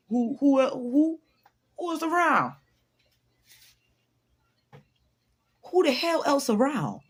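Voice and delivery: high, loud mock exasperation; exasperated insistence. Answering someone who doubts being pretty, exasperated. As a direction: push it high and loud, incredulous. Acted reply to doubting stranger.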